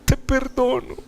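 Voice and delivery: high, soft, pleading re-enactment; emotional plea. Retold or imitated. Son embracing his father, high emotional plea. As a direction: plead softly, close to tears.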